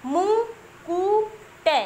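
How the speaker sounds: "मु, कु, टे" as separate sounds with pauses between; syllable by syllable. Shown as a demonstration. Teacher showing children how the word is sounded, "मु, कु, टे" syllable by syllable. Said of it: "ऐसे ही बोलते हैं ना".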